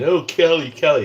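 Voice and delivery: hammed-up mock Californian; mocking Cali. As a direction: sneer it like the people you mock. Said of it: "a person from California saying".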